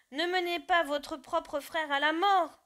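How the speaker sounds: loud, slow pleading cry; pleading shout. Acted. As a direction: beg them slowly and loudly not to do it. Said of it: "criaient les animaux".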